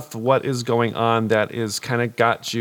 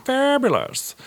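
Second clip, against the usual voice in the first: campy drag queen; 11 semitones higher, slower than usual. Acted. Drag queen answering campily.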